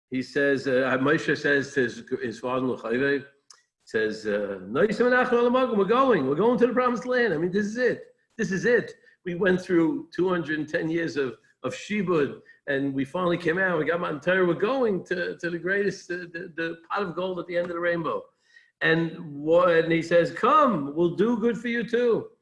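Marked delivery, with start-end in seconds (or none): 4.6-18.2 high and bright with hope, pushing forward; eager excitement. Retold or imitated. Moshe urging his father-in-law on, raised and excited. 20.3-22.3 high, eager call to come along; eager invitation. Retold or imitated. Moshe pressing Yisro to join, lifted and eager.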